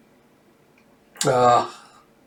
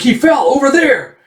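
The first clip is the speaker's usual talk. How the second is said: high, loud alert to the others; acted alarm, pitched right up; excited shout; 12 semitones higher, 5 dB louder than usual. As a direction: call out across a distance. Pursuers shout where he fell.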